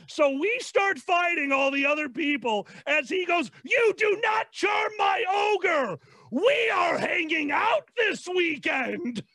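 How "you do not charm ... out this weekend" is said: high, drawn-out outraged yell; scream. Acted. Defending my ogre from being charmed, screaming. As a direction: scream it slowly, stretching every word. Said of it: "the scream".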